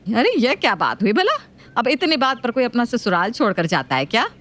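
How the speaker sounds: nagging mother-in-law voice. Acted.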